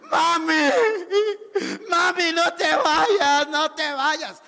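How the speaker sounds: loud, slow, tearful fake sobbing; mock wailing son. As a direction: wail over the coffin like a selfish son. Bad son wails at coffin.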